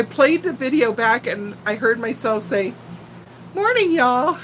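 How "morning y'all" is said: higher-pitched Texas "y'all" greeting; Texas accent. Retold or imitated. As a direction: imitate your own slipped-out Texas twang. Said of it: "lose my Texas accent".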